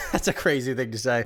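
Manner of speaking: laughing at your own slip; amused, laugh spilling into the words; said through a chuckle. Real, not acted.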